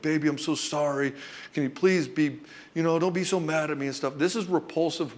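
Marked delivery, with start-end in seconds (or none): 0.0-3.9 imitating a guilty husband's plea; mildly mocked begging for forgiveness. Guilty husband asking wife not to be mad, begging. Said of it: "begging for approval and you're asking for forgiveness".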